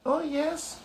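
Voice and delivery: a tiny voice from a dust speck; squeaky little mayor; tiny Who voice. Acted. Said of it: "piped the voice".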